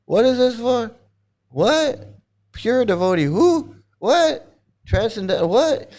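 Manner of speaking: high, incredulous questioning; bewildered ex-devotee. Retold or imitated. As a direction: act out a confused devotee who forgot why. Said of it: "He's like".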